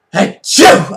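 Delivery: loud imitated sneeze "shoo"; hammed-up sneeze; mock sneeze. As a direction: sneeze it out loudly. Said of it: "I had this".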